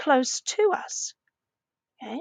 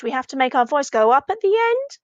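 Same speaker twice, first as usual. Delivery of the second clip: "we have to make our voice go up at the end" climbing to a peak on "end"; rising at the end. Shown as a demonstration. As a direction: demonstrate the lift by rising on "end". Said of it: "can you hear when we ask".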